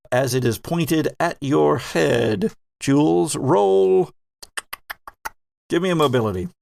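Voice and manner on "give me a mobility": normal talking voice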